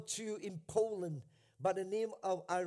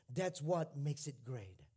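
retold tough-love retort; 5 semitones lower, slower than usual. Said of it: "spouts back at her".

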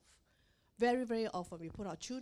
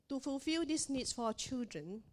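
usual speaking voice

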